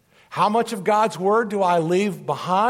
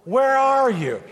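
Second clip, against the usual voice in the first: loud searching call, higher; 6 semitones higher, 4 dB louder, slower than usual. Shown as a demonstration. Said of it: "One more time".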